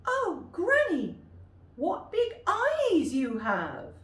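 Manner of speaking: put-on very high loud girl; girl staring at granny; wondering little girl. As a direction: gasp it out in wonder. Girl questions grandmother.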